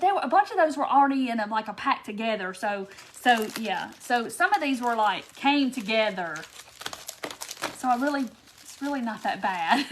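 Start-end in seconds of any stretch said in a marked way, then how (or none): none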